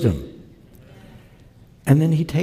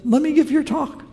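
voiced chauffeur; 13 semitones higher than usual.

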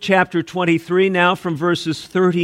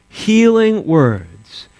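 slow emphatic repeat; 4 semitones lower, 3 dB louder, much slower than usual. Acted. Preacher repeating phrase for emphasis.

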